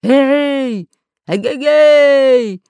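high, very loud long-drawn hail; booming stretched-out call; thunderous shout. A narrator's reading. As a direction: holler to someone far up in the sky. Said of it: "закричал громовым голосом Буль-Буль".

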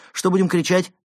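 high, loud, gleeful question; eager. A narrator's reading. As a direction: ask it keenly, pitched right up. Robber eagerly joins the shouting.

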